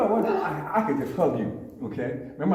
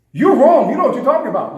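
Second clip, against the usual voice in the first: much louder, higher rebuke; loud confrontation; 9 semitones higher, 11 dB louder than usual. Shown as a demonstration. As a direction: come down hard on the bigot. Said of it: "you know what I said?".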